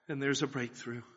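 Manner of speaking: ordinary talk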